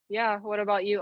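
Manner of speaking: ordinary delivery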